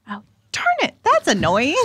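high, loud, exasperated complaint; exasperated outburst. Real, not acted. Player finds out her spells are still first level, exasperated. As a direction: burst out loudly in annoyance.

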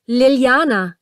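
loud cry after a dead line; Matthias shouts after Liliana as she hangs up; shouted name. A narrator's reading. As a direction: call out her name in shock. Caller hangs up on him.

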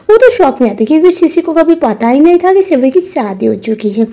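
plain reading voice

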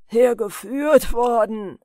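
loud, slow, drawn-out scornful echo; jeering. A narrator's reading. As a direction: throw the words back with loud mockery. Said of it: "höhnte der andere". (höhnisch nachgeäfft, laut und gedehnt)

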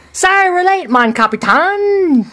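squeaky-high sidekick greets his king grandly; Timon voice. Acted.

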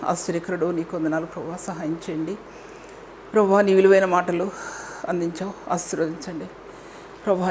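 soft, low closing prayer. Acted. Pastor closing the sermon in soft, humble prayer. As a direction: pray quietly to the Father.

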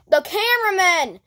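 ordinary talk, nothing marked

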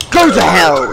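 hammed-up angry traveller. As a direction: snap it as the rejected traveller. Voicing angry rejected traveller.

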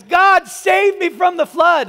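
high, slow pleading shout; desperate cry from the rooftop; crying out to God. Retold or imitated. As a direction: yell it up to the sky for rescue. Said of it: "he cries out to God".

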